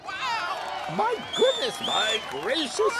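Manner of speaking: high, thrilled exclaiming; amazed shout. A narrator's reading. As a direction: gasp it out in delight. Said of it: "they shouted".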